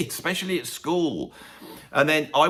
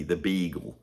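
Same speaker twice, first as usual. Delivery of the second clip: ordinary talk, nothing marked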